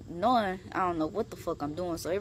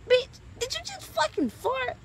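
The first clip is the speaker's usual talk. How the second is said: much higher, louder outrage; furious high disbelief; outraged accusation; 4 dB louder than usual. Retold or imitated. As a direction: shriek the accusation. Cat farted in his face.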